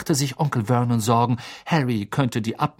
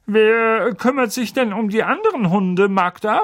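higher, louder friendly question; hearty uncle; 9 semitones higher, 4 dB louder than usual. Acted. Uncle asking his sister about her dogs, hearty. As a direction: ask with big host cheer. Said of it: "fragte Onkel Vernon".

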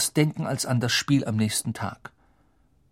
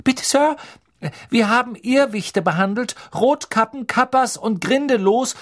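much higher, louder eager rush; bubbling over; 11 semitones higher, 4 dB louder than usual. A narrator's reading. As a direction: blurt it all out eagerly. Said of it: "sprudelte Hermine los".

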